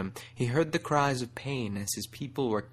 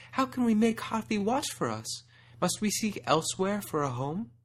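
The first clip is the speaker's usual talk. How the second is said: high, frightened pleading voice; frightened doe; 10 semitones higher than usual. A narrator's reading. The doe pleading with Kargash in fear. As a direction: beg for an answer. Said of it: "a doe cried".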